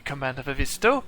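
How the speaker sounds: high, polite robot medic; chirpy artificial helper voice. Acted.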